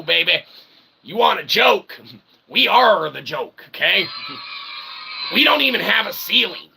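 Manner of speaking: ordinary talk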